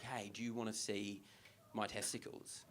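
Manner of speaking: low, hushed party offer; furtive proposition. Acted. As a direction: sidle up and ask very softly. Imagined creepy offer at a party.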